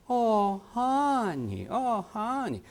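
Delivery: tender comforting. Shown as a demonstration. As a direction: coo it to a hurt child. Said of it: "That's how you say it".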